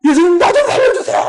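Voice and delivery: high, wailing imitation of sobbing; crying plea. Possessed woman begging Jesus to save her, crying. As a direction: beg tearfully, voice rising into a wail. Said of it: "그러면서 울기 시작하는데".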